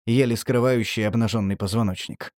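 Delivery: ordinary delivery, nothing marked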